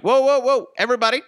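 very high, loud mocking voice; put-on high-pitched protest; mock scolding objector. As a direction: squeal out a fussy correction, hammed-up. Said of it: "I'm not gonna be like".

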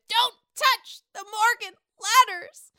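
put-on drunk Sonia. Host imagines drunk Sonia yelling, drawn-out and loud. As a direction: bellow it like tipsy Sonia. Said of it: "I just pictured Sonia with".

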